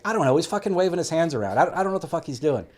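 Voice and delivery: agitated retold outburst; exasperated retort. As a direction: imitate a teammate loudly venting his confusion. Retold exasperated teammate answering.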